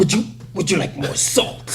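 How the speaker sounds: hammed-up, hesitant kingpin voice. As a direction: stammer it like an awkward crime boss. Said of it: "the way Kingpin talks".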